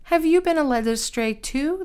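mock the guard for being fooled. A narrator's reading. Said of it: "the Pharisees mocked".

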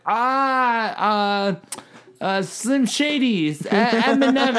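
high, slow, halting tourist who pretends not to know English; faking no English. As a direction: stretch it out, high and unsure, as if you barely know English. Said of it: "I pretended like I didn't really know English".

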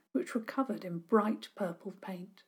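ordinary delivery

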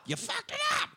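high mock shout at Ellis. Hostage-taker screaming at Ellis, mock shout. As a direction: yell it as if blaming Ellis.

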